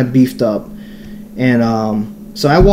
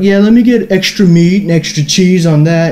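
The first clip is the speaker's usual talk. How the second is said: higher-pitched mock ordering voice; show-off customer; 5 semitones higher than usual. Beanie kid orders meat in front of vegetarian buddy, showing off. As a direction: imitate him ordering for an audience. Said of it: "he's got the fucking stage now".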